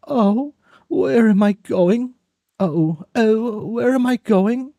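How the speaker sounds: high, polite urchin voice; Oliver Twist voice. Acted. A friend agreeing on the phone to leave, in a high orphan voice. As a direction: answer like the timid orphan.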